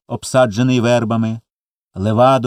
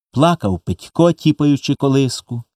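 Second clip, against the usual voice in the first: ordinary delivery, nothing marked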